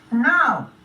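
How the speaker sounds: high, loud little snail; high-voiced snail announcement; snail number one. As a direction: get down to business as a tiny snail. Snail number one addresses Spot.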